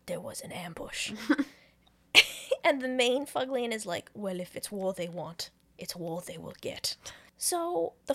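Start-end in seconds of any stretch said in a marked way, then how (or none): 0.1-1.1 hushed, breathy whisper of an ambush; dramatic whispered alert; whispered warning. Retold or imitated. Fuglian warns hiding comrades. 4.1-7.0 low, softer voice sinking to a whisper; mock-grave villain threat; hammed-up hushed war declaration; menacing vow. Main Fuglian vows revenge.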